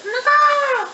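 bird call. Shown as a demonstration. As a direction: squawk it like a parrot. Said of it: "The bird says".

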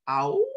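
high, loud happy dog howl. Acted. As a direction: howl for joy. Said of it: "howled Trusty".

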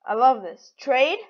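ordinary delivery

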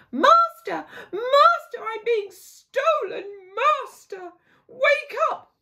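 high, loud, panicked cries for help; harp shouts. Acted. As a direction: scream for the master to wake. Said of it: "the harp shouts".

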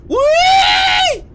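high, loud gleeful whee; excited squeal. Acted. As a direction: squeal with joy on the way down. Sliding down playground slide.

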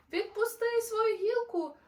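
high, loud call from below; urgent shout. Acted. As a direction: shout up to make him let go.

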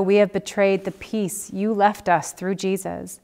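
usual speaking voice

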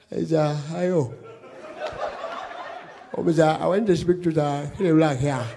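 calm elderly-statesman impression, hushed and unhurried; Muhammadu Buhari. President on the phone asking for the comedian, very calm. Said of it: "Very calm guy".

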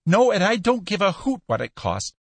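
high, urgent dismissal; impatient insistence. A narrator's reading. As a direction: brush the question aside frantically. Buyer impatiently dismisses the price.

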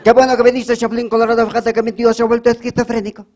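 high, loud Spain-Spanish dub voice; Castilian dub. Acted. Victim greeting Chapulín in a high loud Castilian dub. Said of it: "doblado al español".

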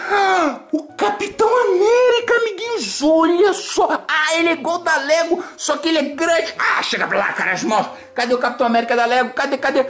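high, gushing toy presenter; kids show host. Acted. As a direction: thrill the little viewers with each toy. Host shows toys to kids. (apresentador infantil animado)

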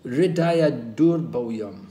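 slow, weighty Hridaya durbhoyam, each syllable laid out; first slow recital of Hridaya durbhoyam; slow emphatic Sanskrit. Shown as a demonstration. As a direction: dwell on Hridaya durbhoyam slowly. Sanskrit term said twice.

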